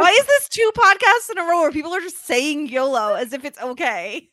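loud, high exasperated protest; mock outrage. Co-host objects to yolo two podcasts running, mock outraged. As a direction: demand an answer in playful indignation.

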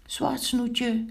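high-pitched call to a friend. A narrator's reading. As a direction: call the name out high, as a storybook figure. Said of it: "riep Pinkeltje". (hoog roepend)

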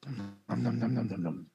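playful nom-nom chomping; eating noises. Retold or imitated. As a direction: mimic a baby gobbling food, lightly. Imitating baby eating.

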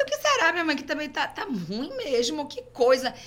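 high, puzzled wondering; puzzled self. Retold or imitated. Her reply to mother, retold.

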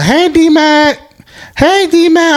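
put-on high, bright girly hey; girly call. Girls at the club calling out to him, high and sweet.

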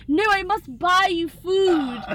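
high and loud imitation of a British accent; refusing in a hammed-up British accent; mock British accent. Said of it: "putting on the British accent".

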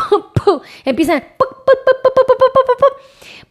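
high, playful popcorn pops; popping sound effect. Retold or imitated. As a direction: pop pop like bursting kernels. Imitating popcorn kernels popping.

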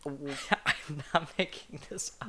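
slow words squeezed out between laughs; laughing protest. Real, not acted. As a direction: insist through breathy laughter. Insisting while cracking up at own story.

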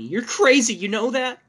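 high, loud furious yell; wife screams at the intruding husband; shouting wife. A narrator's reading. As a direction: scream it at him in fury. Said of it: "my wife shouted at me".

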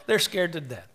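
ordinary talk

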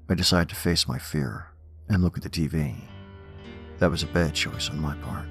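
usual speaking voice

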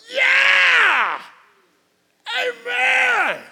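very high, loud shouted amen; crowd shouting. Retold or imitated. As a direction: whoop it up like a worked-up congregation. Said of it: "everybody was like".

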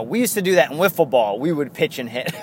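normal talking voice

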